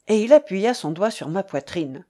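ordinary delivery, nothing marked

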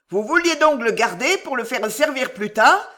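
lightly voiced heckler; voice from the crowd. A narrator's reading. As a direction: call out mockingly from the crowd. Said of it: "dit une voix dans la foule".

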